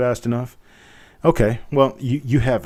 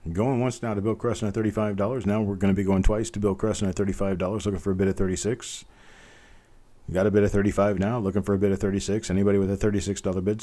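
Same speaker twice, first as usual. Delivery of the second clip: brisk auction patter, counting it down; fast auction call to a final close; auctioneer countdown; 4 dB quieter, faster than usual. Acted. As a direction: press the room for a last bid, fast.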